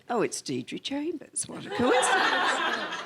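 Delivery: mock Deirdre Chambers line rising high into a laugh; high, laughing catchphrase impression; quoted film catchphrase. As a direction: toss off the famous line with a giggle. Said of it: "these catchphrases like".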